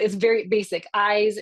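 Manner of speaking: ordinary talk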